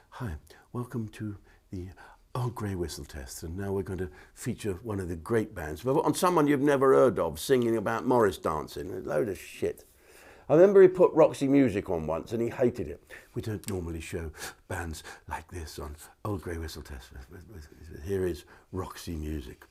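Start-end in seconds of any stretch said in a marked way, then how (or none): 0.1-5.8 hushed, low TV host murmur. Acted. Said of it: "He's been that [name]". 13.3-19.6 very soft, low, grudging introduction. Acted. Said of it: "He's been that [name]".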